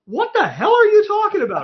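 baffled outrage. Retold or imitated. Retold phone call, confused outrage.

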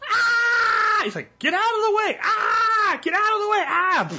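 high, frantic repeated screaming; panicked movie yell. Retold or imitated. Retelling the steamroller scene, shrill panicked shouting. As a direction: scream like a man stuck before a slow steamroller. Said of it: "And the guy's like".

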